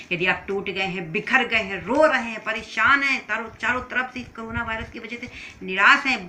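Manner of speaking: loud, urgent exhortation; impassioned preaching. Real, not acted. Preacher exhorts the broken listeners.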